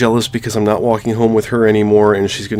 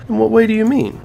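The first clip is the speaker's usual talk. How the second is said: higher, put-on girl's voice for Monika; playing Monika; 12 semitones higher, 4 dB louder than usual. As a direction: read Monika's lines in a lifted, girlish voice. Voicing Monika.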